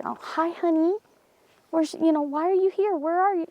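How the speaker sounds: imitating him, pitched high as if to a child; talking to a child. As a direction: mimic a man chatting gently to a little kid. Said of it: "he was talking weird".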